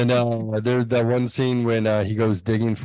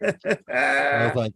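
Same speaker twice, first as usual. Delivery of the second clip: ordinary delivery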